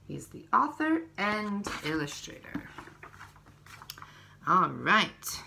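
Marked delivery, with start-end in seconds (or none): none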